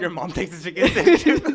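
loud, high, through laughter; laughing. Real, not acted. Teasing friend about mom and chicken strip, laughing. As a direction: crack up while joking loudly.

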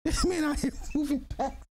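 high, hyped-up shout-out; excited hype. Real, not acted. Shouting out Macbone to the chat, excited and high. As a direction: hype him up, voice way up.

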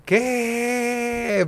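high disbelieving yelp; surprised what. Real, not acted. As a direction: caught off guard again. Hears it's the same street.

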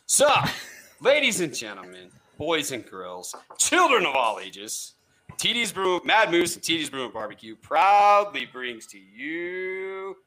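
slow, grand showman introduction; ringmaster. Acted. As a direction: roll out the big welcome. Game show host introducing guest.

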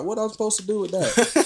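put-on high, squeaky old lady. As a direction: pitch way up like an elderly woman. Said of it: "start using my old lady voice".

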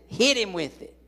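loud, high "hit him with it"; loud comic retort; shouted punchline. Retold or imitated. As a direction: bark the answer for laughs. His reply, punchline shouted.

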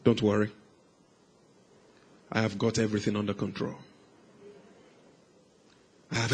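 low, very soft, soothing voice; gentle reassurance. Retold or imitated. The Lord answering the shouting boy, softly reassuring. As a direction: drop right down and calm the listener.

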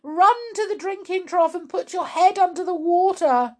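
high, loud urgent shriek; high-pitched alarmed shouting. A narrator's reading. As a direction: yell frantic orders at the top of the voice. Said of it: "shrieked Missus Cranky".

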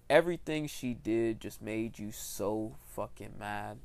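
usual speaking voice

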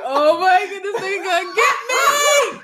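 high, crying shout of terror; hammed-up crying scream; mock crying. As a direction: wail it like you're being chased. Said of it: "started crying like".

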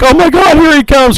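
high, loud cry of alarm; hammed-up panicked sighting; mock alarm. Host voicing survivors seeing Jason fly in, mock panicked. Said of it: "They're like".

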